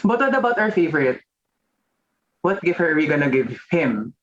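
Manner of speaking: ordinary talk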